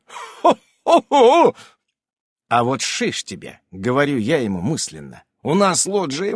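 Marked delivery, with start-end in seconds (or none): 0.1-1.6 slow, high, loud ho-ho-ho; hammed-up booming laugh; mooing laugh. A narrator's reading. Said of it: "Мычит он".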